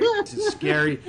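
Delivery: high squeaky frightened voice; mock scared. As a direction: play a timid old ump. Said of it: "Old man ump is getting scared now".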